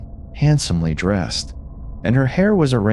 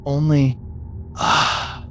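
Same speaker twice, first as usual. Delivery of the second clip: panted; 2 semitones higher, slower than usual. A narrator's reading. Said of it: "panted the other".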